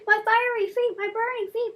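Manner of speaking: high anguished scream of pain. A narrator's reading. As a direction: cry out as if your feet are on fire. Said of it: "the Falco screaming".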